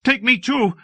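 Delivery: put-on a small child pleading, high and urgent; frightened boy begging to go along; cried in fear. A narrator's reading. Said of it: "and I cried in fear".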